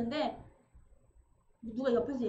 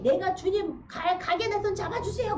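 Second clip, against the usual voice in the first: pleading prayer; 6 semitones higher, 4 dB louder than usual. Retold or imitated. Voicing a dying person's prayer.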